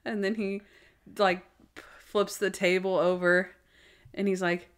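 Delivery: ordinary talk, nothing marked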